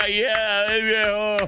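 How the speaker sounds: high, mocking agreement through laughter; laughing disbelief. Real, not acted. Host pretending to believe the candidate, laughing. Mocks the denial while laughing.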